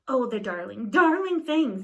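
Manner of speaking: dote on the piglet; gushing. Acted. Husband gushing over the piglet, loud.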